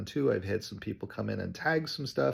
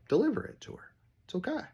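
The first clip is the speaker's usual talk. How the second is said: cheery reassurance; 13 semitones higher, faster than usual. Retold or imitated. Cheery reply to client.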